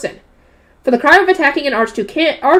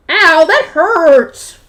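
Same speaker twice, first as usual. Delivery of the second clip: pained ow; 2 semitones higher, 4 dB louder, much slower than usual. Acted. Pinched on cheek, cries out.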